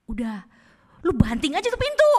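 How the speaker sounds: high, loud goading voice; devil egging on. Acted. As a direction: play the devil urging someone into a rage. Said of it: "dia mulai ngomong kayak gini".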